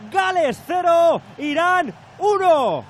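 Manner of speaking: slow, loud score call; score shout. Acted. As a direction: bellow the scoreline slowly. Announcing the score after goal.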